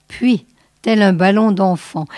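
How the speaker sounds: ordinary delivery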